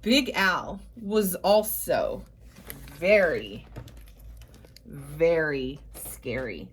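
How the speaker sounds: low, slow build to very scary; mock-spooky storyteller pause; spooky emphasis. A narrator's reading. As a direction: drop the voice down on very, very scary.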